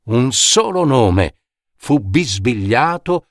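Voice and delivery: ordinary delivery, nothing marked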